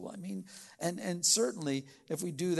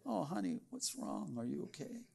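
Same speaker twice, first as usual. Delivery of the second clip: much softer, tender concern; caring and gentle; 10 dB quieter than usual. Shown as a demonstration. Spouse comforting their partner at home, soft and tender. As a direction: ask gently like you truly care. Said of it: "words spoken with kindness".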